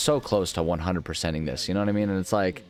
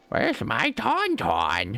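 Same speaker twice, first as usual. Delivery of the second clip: slow silly voice; 5 semitones higher, much slower than usual. Pretend. Slow put-on voice.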